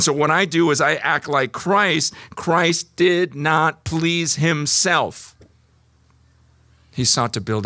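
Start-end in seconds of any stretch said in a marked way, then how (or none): 2.3-5.4 each word laid down slowly; very slow emphasis. Shown as a demonstration. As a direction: spell it out to drive it home. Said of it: "repeated verse slowly".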